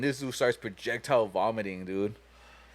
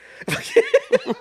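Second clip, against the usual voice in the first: loud high-pitched cackle; real laughing fit at his own story; 6 dB louder than usual. Real, not acted. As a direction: lose it laughing. Laughs at his own gross punchline.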